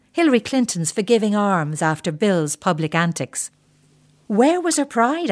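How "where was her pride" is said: high-pitched, mildly scornful question; scoffing. Retold or imitated. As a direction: scoff lightly, pitch lifted well up. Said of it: "I scoffed at other women".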